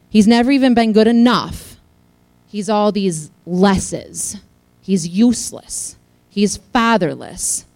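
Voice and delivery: building, loud preaching with slow, heavy repeats; rising sermon fervor, all these lesses drawn out slowly. Acted. Preaching about Kyle's labels.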